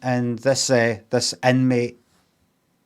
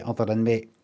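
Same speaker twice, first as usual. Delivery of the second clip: usual speaking voice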